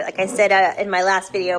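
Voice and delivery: ordinary delivery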